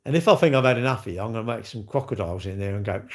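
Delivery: ordinary delivery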